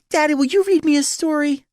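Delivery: put-on very high, loud child voice; little girl. A narrator's reading. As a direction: ask eagerly like a four-year-old. Small daughter begs her father.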